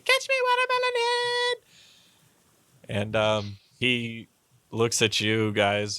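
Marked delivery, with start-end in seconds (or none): 0.0-1.6 very high, squealed taunt; screaming taunt. Acted. Half-orc girl running off to the bow, high screamed taunt. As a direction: shriek it in a tiny high voice.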